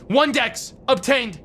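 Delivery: high, slow game announcer. Pretend. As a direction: announce it like a video game reward.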